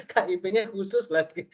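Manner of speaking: real laugh, high and bright, then laughing through words; preacher laughing at his own joke about counselling. Real, not acted. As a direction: chuckle at the joke.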